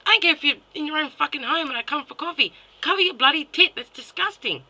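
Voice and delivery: real anger at full volume; furious outburst. Real, not acted. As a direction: yell it as a disgusted telling-off. Angry rant peaks.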